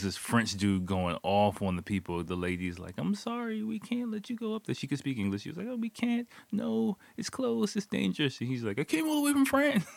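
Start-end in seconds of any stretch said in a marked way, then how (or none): 3.0-4.8 high-pitched imitation of an apologetic lady; lady refusing. Staff lady turning a French hiker away, high-pitched apologetic imitation. 5.7-8.4 high mock-lady voice; lady refusing. Staff lady warning hiker of danger, high-pitched imitation. 8.8-9.9 very high-pitched mock protest; protesting hiker. French hiker arguing with staff lady, high mock-protesting voice.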